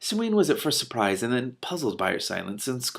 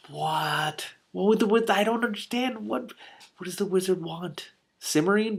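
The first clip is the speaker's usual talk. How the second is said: much higher, confused questioning; bewildered reader blurting questions up high; baffled; 10 semitones higher, slower than usual. Real, not acted.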